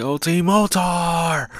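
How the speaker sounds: mock anime attack shout; attack call. Retelling manga, villain's attack call.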